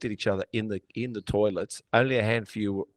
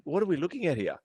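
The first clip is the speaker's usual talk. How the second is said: higher, restrained puzzled question; bemused disbelief; 6 semitones higher, faster than usual. Retold or imitated. Watching gym posers post, bemused and restrained. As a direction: wonder it in disbelief, held back. Thinking about posers, first take.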